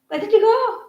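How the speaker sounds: high pet-talk coo. Real, not acted. Owner cooing to her pet, high and sing-song. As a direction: coo it up high like talking to a puppy.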